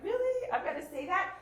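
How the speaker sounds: high-pitched, disbelieving protest; incredulous objection. Acted. Sceptical parent reacting to the empathy script, incredulous. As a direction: balk at it, high and lively. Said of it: "you're laughing because you're kind of like".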